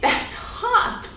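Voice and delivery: breathy, high answer; excited reveal. Shown as a demonstration. Teacher revealing the guessed word hop with delight. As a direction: announce hop with glee. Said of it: "what is that".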